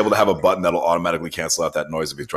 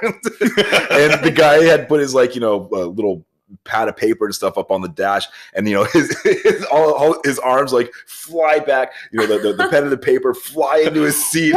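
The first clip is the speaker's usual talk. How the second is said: higher, louder, laughing through the story; laughing while telling; 6 semitones higher, 4 dB louder than usual. Real, not acted. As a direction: crack up as you describe it. Laughing retelling of the jolt.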